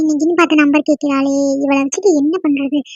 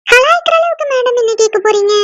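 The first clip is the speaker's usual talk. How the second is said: much higher, mock-deferential lilt; playful teasing; 8 semitones higher than usual. Friend teasing Sandhya before her request, playful. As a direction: tease her with a high-pitched question.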